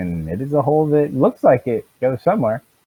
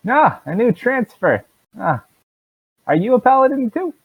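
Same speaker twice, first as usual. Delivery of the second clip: cheery guard; 9 semitones higher, 4 dB louder than usual. Acted. Guard greets new paladin.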